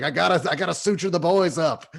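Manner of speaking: high, loud frantic teacher voice; urgent squeaky medic bit; high-pitched teacher. Retold or imitated. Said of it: "He's like".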